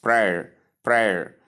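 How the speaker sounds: prayer as one syllable rhyming with air; prayer as P-R plus the diphthong "air"; correct pronunciation. Shown as a demonstration. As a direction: model prayer slowly, PRAIR, like wear. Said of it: "It gives you".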